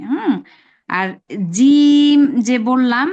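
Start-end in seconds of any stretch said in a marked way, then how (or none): 1.6-2.2 জিম held long and slow, a little louder; three-alif stretch. Shown as a demonstration. Teacher demonstrating the long vowel in জিম. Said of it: "এইখানে তিন আলিফ টান হবে".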